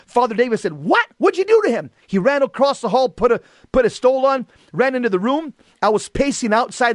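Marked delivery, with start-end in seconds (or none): 0.7-1.9 surprised. Retold or imitated. Priest shocked at the news.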